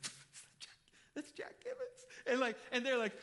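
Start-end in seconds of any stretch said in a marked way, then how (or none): 0.0-2.1 imitated starstruck whisper rising to a squeak; hushed, high-pitched fan excitement; giddy fans. Older couple spotting the famous Jack Givens, giddy whispering. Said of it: "this older couple get kinda giddy".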